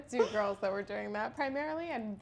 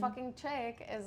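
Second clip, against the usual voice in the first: normal talking voice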